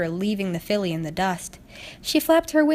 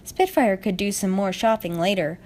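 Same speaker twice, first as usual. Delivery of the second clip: ordinary delivery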